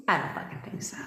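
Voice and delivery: hushed, teasing taunt; unvoiced teasing whisper; mock whisper. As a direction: breathe it like a secret dare.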